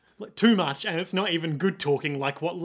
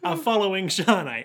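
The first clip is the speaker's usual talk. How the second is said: ordinary talk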